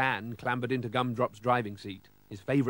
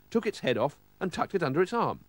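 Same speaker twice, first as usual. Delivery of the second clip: plain reading voice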